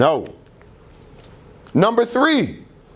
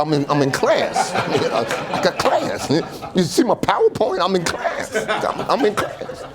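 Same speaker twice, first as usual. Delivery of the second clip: laughing through words; 4 semitones higher than usual. Retold or imitated. Acting own reply to fans, laughing.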